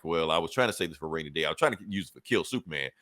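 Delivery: low, quick offhand villain; lightly voiced smug Lex Luthor. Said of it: "Luthor's like".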